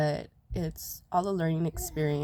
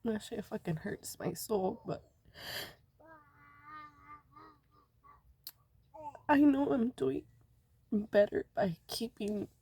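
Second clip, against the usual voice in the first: crying; 5 semitones higher, 4 dB quieter than usual. Real, not acted. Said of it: "Why are you crying, Mommy?".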